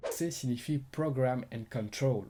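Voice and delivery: French speaker putting on a hammed-up English accent; mock English accent. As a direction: read it like a slow, playful American. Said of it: "vous avez kiffé mon accent anglais".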